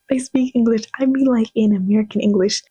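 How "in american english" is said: ordinary delivery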